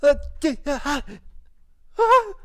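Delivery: very high, loud hammed-up shrieking; mock panicked scream. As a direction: shriek in pretend terror, begging it to stop. Acts out panic at piercing stare.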